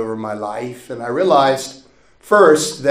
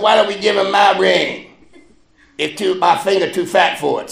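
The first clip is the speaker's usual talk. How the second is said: louder, higher impression of a father; comic loud dad impression; dad's voice; 7 semitones higher, 5 dB louder than usual. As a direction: put on Dad's loud voice for laughs. Voicing dad offering his ring.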